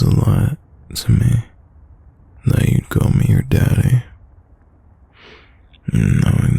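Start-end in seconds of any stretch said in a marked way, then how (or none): none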